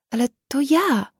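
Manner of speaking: put-on high, breaking girl's plea. Daughter pleads with her parents, high and breaking. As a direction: let the voice crack as she pleads. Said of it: "łamiącym się głosem".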